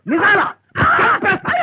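high, rising indignant outburst; outraged threat. Acted. Guru threatening his blurting disciple, high and outraged. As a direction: explode in offended disbelief.

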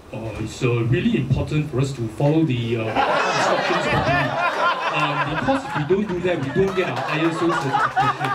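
low, stuffy corporate voice; deep-voiced corporate Singlish; Singaporean corporate type. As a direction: imitate a self-important office executive. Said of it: "but they, their voice is".

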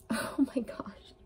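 breathy whispered oh my gosh; whispering in awe at the card; awed whisper. Real, not acted. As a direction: drop to a stunned whisper. Awed reaction during reading.

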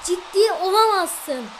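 high, loud, slow cry of disbelief; can't believe the goal just went in; shocked disbelief. Real, not acted. (şaşkın, inanamayan haykırış)

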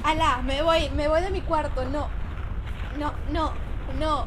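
loud panicked outburst; real startled yelling; panicked shout. Real, not acted. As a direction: yell it out in fright, fleeing. Panicking at scary game scene.

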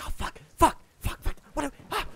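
loud, urgent whispering; panicked stage whisper. Acted. Game master voicing panicked people floors above, stage-whispering. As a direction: hiss it like frantic stage whispers. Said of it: "like, like, stage whispers".

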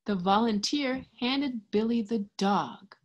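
ordinary delivery

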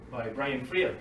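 ordinary talk, nothing marked

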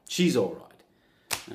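very slow, stretched "She's all right"; long, slow, calming "She's all right"; drawn-out reassurance. Real, not acted. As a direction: drag it out soothingly. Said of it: "drawn out reassurance".

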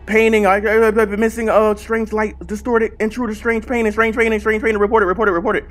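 high, stammering rush of options; flustered panic. Real, not acted. Gamer scrambles to report the strange painting, flustered panic. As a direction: trip over the words, repeating them frantically. Panicked menu choices in game.

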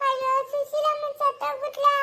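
high-pitched wail of dismay; shocked grief. Acted. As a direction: cry out at terrible news. Shocked at news of death.

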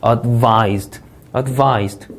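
advised, strong second syllable VISED, then falling; stressed syllable in advised. Shown as a demonstration. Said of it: "이음절 강세가 되겠고요".

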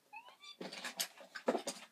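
high squealing laugh burst; shrieking laugh. Real, not acted. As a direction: squeal with giddy panic. Timer starts, shrieking laugh.

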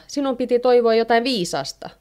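high, exasperated reproach; irritated. A narrator's reading. Wife reproaches husband over his wasted wish, irritated voice. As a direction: scold him, mildly worked up. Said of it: "hermostuu Heinoska".

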